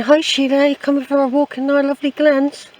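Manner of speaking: a hint of friendly trees greeting her. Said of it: "The trees are welcoming me, saying".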